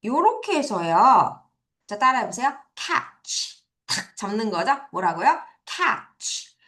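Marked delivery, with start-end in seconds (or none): 2.8-3.6 "Catch" with the T almost silent; barely-sounded T. Shown as a demonstration. Teacher modelling "Catch" for students to repeat, T barely sounded. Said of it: "T 소리가 아주 살짝 나고 거의 안 나요". 5.7-6.6 "Catch" with hardly any T; barely-sounded T. Shown as a demonstration. Teacher answering her own quiz on "Catch", T nearly silent. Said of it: "T 소리가 아주 살짝 나고 거의 안 나요".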